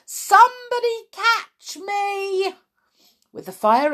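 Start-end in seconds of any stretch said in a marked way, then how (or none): 0.1-2.6 screamed plea. A narrator's reading. Boy sliding out of control, screamed plea. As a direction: wail for someone to save you. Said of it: "screamed Tom".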